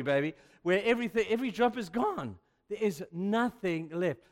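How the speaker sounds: loud, high, full-force preaching; fiery preaching. Real, not acted. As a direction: preach it out with fire.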